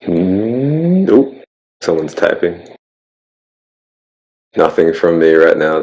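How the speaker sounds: ordinary talk, nothing marked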